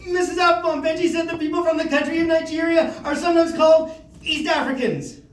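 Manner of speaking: high, urgent schoolkid outburst; going for it. Retold or imitated. Precocious pupil going for it with teacher, high outburst. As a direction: push through it in a high, sustained voice. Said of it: "I'm gonna go for it".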